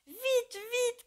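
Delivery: high, loud urgent cry. Acted. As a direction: call for help at the top of the voice. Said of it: "criait-il".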